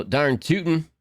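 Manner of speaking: a light mock Texan twang on "Darn tootin'"; Texan accent. Imitating a Texan she can do, mild Texan accent. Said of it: "Those are my two".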